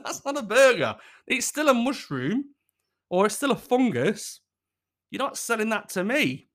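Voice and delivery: high-pitched comic protest; indignant rebuttal. Acted. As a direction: refuse the sale in mock outrage. Indignant reply to burger makers.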